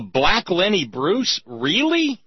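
a disbelieving question that climbs high on Really; can't believe what he just heard; stunned, rising disbelief; incredulous. Real, not acted. Host incredulous at the label.

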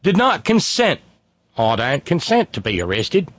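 indignant woman in a bad Australian accent; hammed-up Australian objection; mock Australian protester. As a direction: protest your arrest in broad Aussie. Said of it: "explaining to the police officer".

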